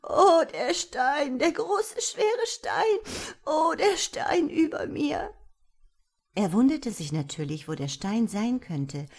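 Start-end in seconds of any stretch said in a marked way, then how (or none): 0.0-5.3 high, slow, wailing little voice; a tiny creature crying under a stone; sobbing wail. A narrator's reading. A tiny trapped creature wailing to be freed, high and weeping. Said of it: "Klagen ... mischten sich mit Schluchzern".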